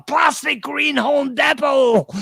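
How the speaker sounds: slow loud declaration; mock shout. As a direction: belt it out slowly. Quoting song title.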